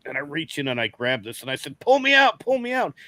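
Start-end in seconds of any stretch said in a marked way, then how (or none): 1.8-2.9 high, frantic mock plea; imitating a panicked shout from a hole; urgent call for help. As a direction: yelp it like you're stuck. Stuck in hole, calling for help.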